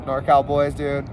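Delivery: normal talking voice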